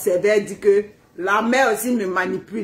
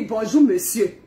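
mock frosty reply; 3 semitones lower, slower than usual. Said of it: "elle dit".

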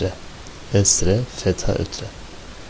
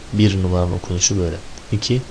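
usual speaking voice

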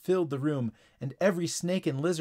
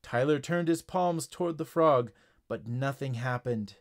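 ordinary delivery

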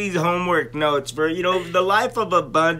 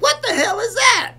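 high, louder startled cry; mock alarmed yelp; 6 dB louder than usual. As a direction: jump at the sight. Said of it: "So I'm like".

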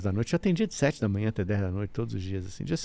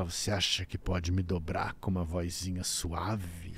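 low, slow, soft menacing voice; eerie voice; 6 semitones lower, 8 dB quieter, slower than usual. Retold or imitated. Possessed client taunting his therapist, low slow menace. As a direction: speak slow and low like a spirit mocking. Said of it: "eu escuto aquela voz falar".

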